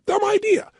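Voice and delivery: high, scoffing dismissal; mock the idea as a doubter; scoffing listener. Skeptical listener scoffing at the idea. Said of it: "You go".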